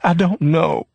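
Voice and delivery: high, tearful sob; sobbing. A narrator's reading. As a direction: choke it out through tears. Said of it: "Leona sobbed".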